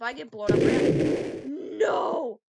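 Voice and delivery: loud drawn-out cry of protest; dismayed yell. Real, not acted. Player getting blown up, loud dismayed yell.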